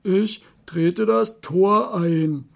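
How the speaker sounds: trumpeting elephant. Acted. Elephant offers to break the gate, trumpeting slowly. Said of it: "trompetete der Elefant".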